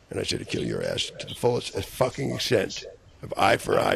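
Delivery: normal talking voice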